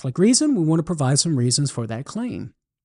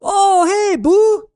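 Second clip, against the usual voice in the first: much higher, louder Cajun greeting across a field; Cajun holler back; 5 dB louder, slower than usual. Acted. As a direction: call back cheerfully in a high Cajun voice. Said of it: "Thibodeau hollers back".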